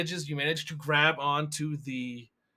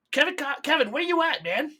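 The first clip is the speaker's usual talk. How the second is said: high, loud, urgent hail; acted impatient shout over comms; calling out; 12 semitones higher, 5 dB louder than usual. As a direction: call for a missing teammate. [name] calls Kevin on comms.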